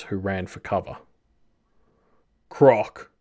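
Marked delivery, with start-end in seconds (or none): 2.5-3.1 short, louder grunt of a name. A narrator's reading. Man carried bodily calls to his captor, grunted. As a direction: grunt it out under strain. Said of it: "he grunted".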